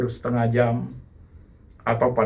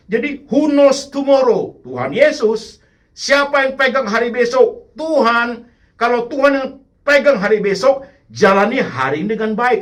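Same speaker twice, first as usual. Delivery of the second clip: high, loud, ringing preacher's call; fiery pulpit call-and-answer; preaching at full force; 10 semitones higher, 5 dB louder than usual. Acted. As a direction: rouse the congregation.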